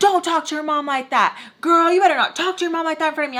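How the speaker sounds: high, fast mock scolding; scolding Barry. To the girl talking back to her mom, a high fast scolding. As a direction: lecture her like a bossy aunt, quickly.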